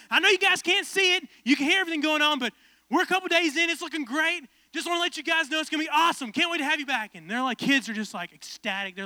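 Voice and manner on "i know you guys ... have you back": high, loud upbeat host; Ty Pennington impression. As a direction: reassure them like a pumped-up host. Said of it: "He's like".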